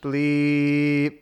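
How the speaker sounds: spoken bleep held on one steady tone; drawn-out, monotone fake censor beep; mock censor bleep.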